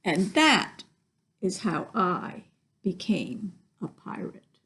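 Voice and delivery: plain reading voice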